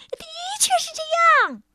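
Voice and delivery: high loud squeaky reply; loud sprite answer. A narrator's reading. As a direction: call out proudly as a sprite. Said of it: "小精灵大声地回答说". (大声回答的小精灵)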